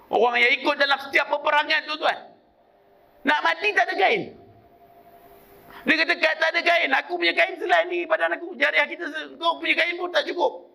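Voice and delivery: high-pitched, driving sermon delivery; fervent preacher pressing the point home; impassioned preaching. Acted.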